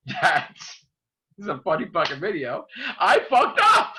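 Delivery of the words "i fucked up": sudden loud self-blame, high; shouting. Real, not acted. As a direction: blurt it out loud at yourself. Realizing his own blunder on camera.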